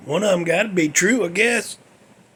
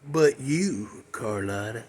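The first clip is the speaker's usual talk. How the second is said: lower, softer after a pause; tender aside; 9 semitones lower, 7 dB quieter than usual. Retold or imitated. Dying comedian to his mistress, low soft aside. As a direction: let the voice drop away for the exception.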